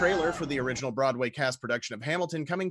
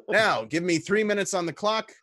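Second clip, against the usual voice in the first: ordinary talk, nothing marked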